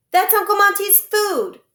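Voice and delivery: put-on boy yelling at the doctor over his uncle's food; angry outcry. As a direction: burst out in fury. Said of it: "Klaus cried out suddenly".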